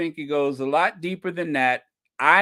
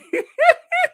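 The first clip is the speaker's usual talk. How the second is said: high squeal of laughter; 7 dB louder than usual. Real, not acted. Laughing at the clip.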